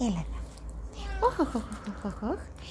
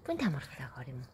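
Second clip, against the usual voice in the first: a fast, soft, lower murmur; soft quick coo; 8 semitones lower, 9 dB quieter, much faster than usual. Real, not acted. Owner murmurs quickly and softly to her pets.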